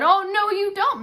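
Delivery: high, loud and firm; no, you're getting up; firm refusal. Acted. Wife insisting her husband wake up, loud and high.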